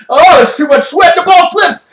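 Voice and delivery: high, loud, alarmed sports call; excited commentary. Acted. Commentator as the sweaty player drops the ball, excited alarm. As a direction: blurt out the mishap as it happens.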